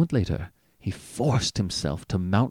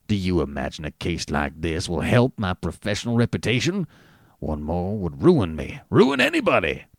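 muttering; 4 semitones lower than usual. A narrator's reading.

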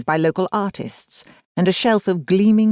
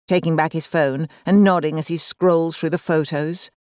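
ordinary delivery

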